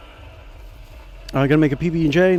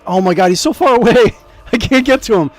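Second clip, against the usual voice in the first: louder, high exasperated outburst with a laugh; 7 semitones higher, 6 dB louder than usual. Real, not acted. Streamer vents that the target is too far away. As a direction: vent at the game. Frustrated at game distance.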